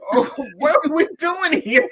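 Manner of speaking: high, loud and cracking up; amused, baffled outburst; laughing disbelief. Retold or imitated. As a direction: question it through laughter. Laughing incredulity at dad's advice.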